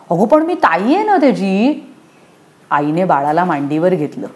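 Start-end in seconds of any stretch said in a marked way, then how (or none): 0.1-1.8 put-on a raised, whiny little-girl plea; whining child. A narrator's reading. Anu whines to mother about being the big sister. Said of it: "Anu कुरकुरली".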